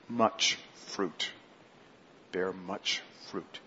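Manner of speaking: slow, hushed repeat of "Bear much fruit"; soft echo. Acted. Preacher echoes the verse softly to the church. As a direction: drop the voice to let it sink in.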